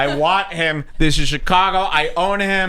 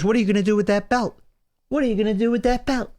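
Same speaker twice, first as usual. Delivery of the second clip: higher, fast mocking taunt; imitating a taunting man; 6 semitones higher, much faster than usual. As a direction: parrot the heckler's challenge, hammed up. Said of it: "And he goes".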